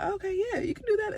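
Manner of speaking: very high, squeaky mock encouragement; fake agreement. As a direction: pitch it way up, faking support. Faking agreement with coworker.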